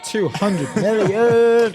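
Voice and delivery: slow, loud, awed exclamation; drawn out and impressed; excited. Real, not acted. Hyping a big funding number.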